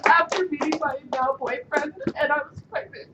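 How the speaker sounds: usual speaking voice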